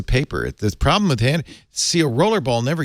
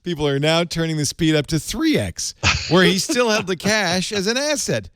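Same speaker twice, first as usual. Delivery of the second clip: limerick recital; 4 semitones higher than usual. Shown as a demonstration. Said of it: "this is the original".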